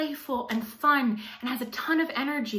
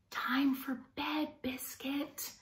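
soft, slow bedtime whisper; 3 semitones higher, 9 dB quieter, much slower than usual. Acted. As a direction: hush it as if the puppy is almost asleep. Bedtime call to sleepy puppy.

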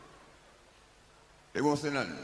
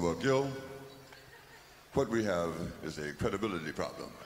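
low, calm producer explaining; a lowered voice for the smug producer; 11 semitones lower, 4 dB quieter than usual. Retold or imitated. Horror producer answers Gil, lowered calm voice.

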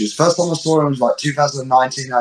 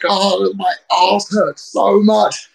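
slow, higher whimpering moan, hammed-up; mock pained crying; 5 semitones higher, much slower than usual. Wrestler on the backstage table imitating his own crying, slow and whiny. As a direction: drag it out like a sore, sorry wrestler.

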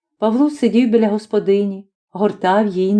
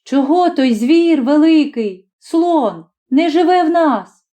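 put-on small boy asking, much higher and slow; a curious little boy, higher voice; child's question; 6 semitones higher than usual. A narrator's reading. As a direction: ask papa wide-eyed like a little boy. Small boy asking his father.

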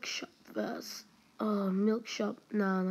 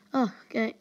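usual speaking voice

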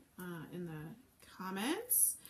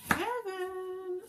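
delighted; 8 semitones higher, 3 dB louder than usual. Real, not acted. Raving about fried plantains.

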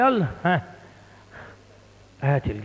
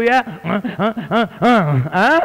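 louder mocking laughter at the boy; 3 semitones higher, 5 dB louder than usual. Parent mocking a slow son, mocking laughter. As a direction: laugh at him scornfully. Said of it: "اقول له".

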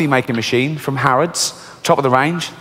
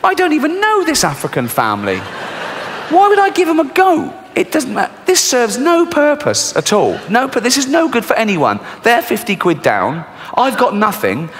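much higher, exasperated complaint; outraged at a pointless gift; indignant rant; 8 semitones higher than usual. Real, not acted. Comedian to audience about the goat present, exasperated.

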